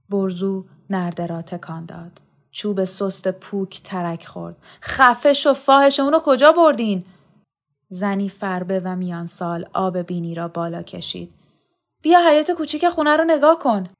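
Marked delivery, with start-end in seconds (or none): 4.8-7.0 high, loud, cursing fury; enraged demand. A narrator's reading. Brother curses and interrogates woman. 12.0-13.9 high, loud, rushed reply; agitated woman. A narrator's reading. Woman answers the enraged brother.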